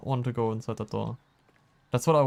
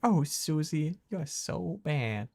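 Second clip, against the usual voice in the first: mock sultry; 4 semitones higher, 5 dB quieter, slower than usual. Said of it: "what the fuck".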